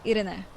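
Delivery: "Irene" said ee-REH-neh, three syllables; "Irene" with tapped r and sounded final e; Spanish pronunciation. Shown as a demonstration. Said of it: "They're Spanish".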